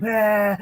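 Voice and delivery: high shouted wail; mock wailing kid. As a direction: cry like a toddler. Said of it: "the kid's like".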